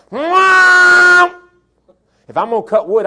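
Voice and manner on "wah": loud high drawn-out roar of a chainsaw. Retold or imitated. Preacher imitating a chainsaw roaring to life, loud and high. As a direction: belt out the saw finally catching. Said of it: "I can go".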